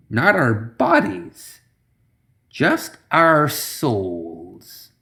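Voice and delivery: very slow, dramatic pause-filled reveal; lightly voiced theatrical witch; drama queen reveal. A narrator's reading. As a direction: savour the twist like a showman. Zoraida drama queen explanation.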